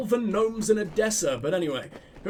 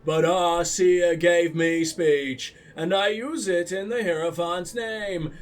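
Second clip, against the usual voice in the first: slow, lower, louder mimicry of a solemn trader; mocking imitation; 4 semitones lower, 3 dB louder, slower than usual. Player parroting a trader's line back at her, slow mocking imitation. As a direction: drag it out ponderously, mocking her delivery. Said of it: "The way you said that".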